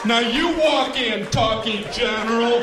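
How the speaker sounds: high, slow, loud chant; singsong toy voice. Acted. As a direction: sing it like a goofy talking toy. Toy voice, sung questions.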